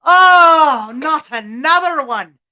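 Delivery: loud, high exasperated groan of words; frustrated leprechaun. Acted. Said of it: "frustrated with all humans".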